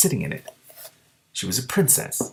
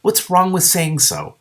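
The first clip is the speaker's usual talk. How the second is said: defensive; 9 semitones higher, 3 dB louder, slower than usual. A narrator's reading. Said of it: "she said defensively".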